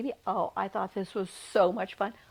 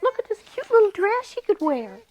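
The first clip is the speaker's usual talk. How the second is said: bright, louder and higher as she shows the dress; delighted show-and-tell; 9 semitones higher, 9 dB louder than usual. A narrator's reading. Storyteller showing children a tiny baby dress, delighted. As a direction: gush over the cute little dress.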